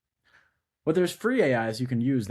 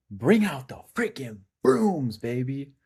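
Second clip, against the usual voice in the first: hyped; 2 semitones higher, slower than usual. Real, not acted. Celebrating sweep, hyped.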